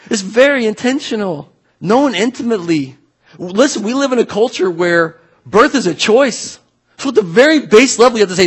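normal talking voice

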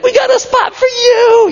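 high-pitched, acted-out excited yell; crowd scream. Crowd calls to Jesus on the pathway, screaming welcome. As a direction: holler it as an eager fan in the crowd. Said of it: "they start to scream toward Jesus".

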